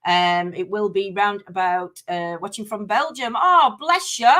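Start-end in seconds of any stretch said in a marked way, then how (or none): none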